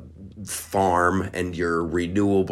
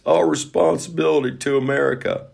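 put-on presidential impression, raised in pitch; Bill Clinton; 5 semitones higher than usual. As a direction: play the CEO as a mock statesman. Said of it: "do this one as Bill Clinton".

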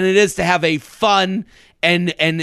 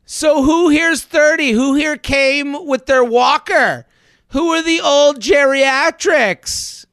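higher, louder, slower teasing instructor; hammed-up instructor quizzing the class; fitness instructor; 7 semitones higher, 3 dB louder than usual. Mocking the instructor's question.

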